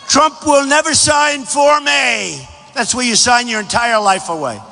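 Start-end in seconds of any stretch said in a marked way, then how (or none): 0.1-2.5 slow, higher mocking voice of the pundits. Rally speaker mocking the pundits' predictions, slow and higher. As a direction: drag it out like a smug know-it-all. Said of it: "Then they say".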